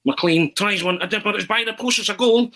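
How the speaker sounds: mock football commentary climbing high to the goal; Arthur Montford impersonation. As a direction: build excitement like a TV match commentator. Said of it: "where the kind of impersonation came from".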